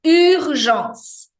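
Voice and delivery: Urgence mais alto, U francês de biquinho; pronúncia francesa. Shown as a demonstration. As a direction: articule Urgence com força. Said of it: "Francês precisa ter articulação". (Urgence bem articulado)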